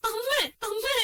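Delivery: high, crying appeals to mother; tearful pleading. Acted. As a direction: desperately beg her to wake, pitch rising. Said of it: "ശ്രുതി, കരയാതെ മോളെ". (കരഞ്ഞുകൊണ്ട് അപേക്ഷിക്കുന്നു)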